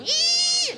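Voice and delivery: high-pitched imitated groundhog shriek; crazed groundhog squeal. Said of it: "starting to get crazy".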